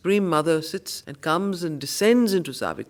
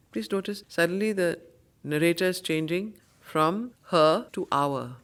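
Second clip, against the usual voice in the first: ordinary talk, nothing marked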